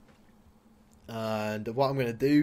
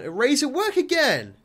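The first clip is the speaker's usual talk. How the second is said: high, louder triumphant shout; excited cheer; 12 semitones higher, 7 dB louder than usual. Real, not acted. Reading his sim's success at work, excited cheer. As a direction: celebrate the news.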